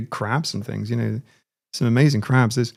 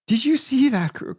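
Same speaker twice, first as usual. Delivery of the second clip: high-pitched attempt at a Scottish accent; amateur Scottish accent, cut short; 13 semitones higher than usual. Shown as a demonstration. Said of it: "I'm gonna do a Scottish accent".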